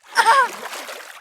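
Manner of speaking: high-pitched gleeful cackle; villain cackle. Acted. Viper hauls herself onto the dock and cackles, high and gleeful.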